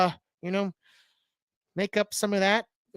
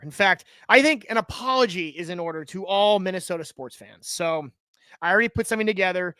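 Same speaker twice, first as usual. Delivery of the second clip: ordinary delivery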